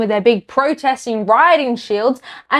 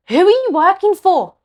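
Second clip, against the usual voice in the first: accusing demand; 7 semitones higher than usual. Real, not acted. Demanding answers from prime ministers.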